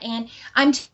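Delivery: ordinary delivery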